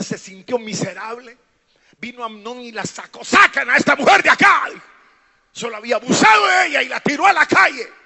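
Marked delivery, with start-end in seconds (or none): 3.2-4.7 loud, high cry of command; shouted order. Retold or imitated. Amnón ordering his sister out, shouted. 5.5-7.9 loud, high, fast preaching; outraged shout. Real, not acted. Preacher telling of Tamar cast into the street, shouting.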